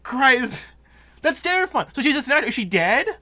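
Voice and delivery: high, loud rattled voice; catching breath after a scare; shaken and breathless. Real, not acted. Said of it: "let me catch my breath".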